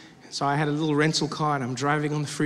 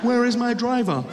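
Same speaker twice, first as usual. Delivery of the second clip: put-on puzzlement, higher than usual; feigned expectation; 5 semitones higher than usual. Comic acting like it was all planned, mock puzzlement. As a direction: pretend you planned it all. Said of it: "I act like I planned it all".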